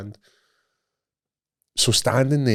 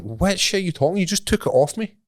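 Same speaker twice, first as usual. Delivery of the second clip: indignant retort; 10 semitones higher than usual. Retold or imitated. Retold indignant reply to guard.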